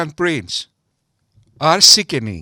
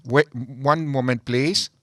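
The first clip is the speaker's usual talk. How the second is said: usual speaking voice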